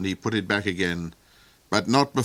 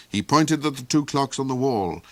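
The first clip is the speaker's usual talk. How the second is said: usual speaking voice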